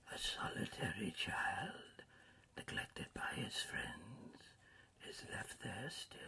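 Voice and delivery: soft, whispered ghost voice; hushed spirit. A narrator's reading. Ghost describing the solitary child left behind, whispered. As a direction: breathe each phrase out softly.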